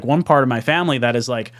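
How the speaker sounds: normal talking voice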